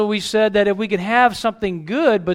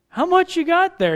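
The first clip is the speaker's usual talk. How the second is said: mock surprise; 10 semitones higher than usual. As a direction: pitch jumps high on the question. Pastor asking about candy.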